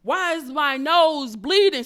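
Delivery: loud, high, slow alarmed question; retold scared cry. Retelling her nose bleeding while crying, acted fright. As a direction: act out alarm, stretching each word loudly. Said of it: "So now I'm scared".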